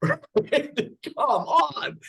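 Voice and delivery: high, laughing mock disbelief. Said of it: "he starts full belly laughing and going".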